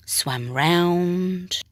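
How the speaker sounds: ordinary delivery, nothing marked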